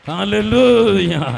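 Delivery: very loud, drawn-out hallelujah cries; shouted praise. Real, not acted. Preacher climaxes in praise before the congregation, shouting. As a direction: climb to a long, high shout.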